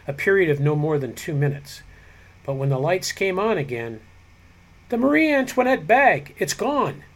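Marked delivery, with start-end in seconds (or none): 4.9-7.0 high, alarmed cry of discovery; alarmed outcry. A narrator's reading. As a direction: cry out that the bag is gone. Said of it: "The outcry came from Eldridge".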